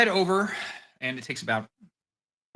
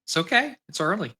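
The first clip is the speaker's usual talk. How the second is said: front desk woman; 9 semitones higher, 4 dB louder than usual. Acted.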